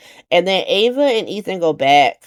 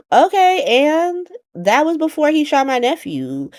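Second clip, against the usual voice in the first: put-on high, pushed-up indignant girl voice; high mock-offended clapback; sassy Ava retort; 11 semitones higher than usual. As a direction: snap back at her, pitched way up.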